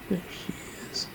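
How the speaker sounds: soft whisper over a sleeping cat. Real, not acted. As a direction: hush so she stays asleep.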